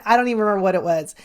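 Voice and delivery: ordinary talk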